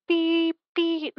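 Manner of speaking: high beeping hang up tone; phone hang up tone. Shown as a demonstration. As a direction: sing out the hang up beeps. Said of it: "that's the hang up one".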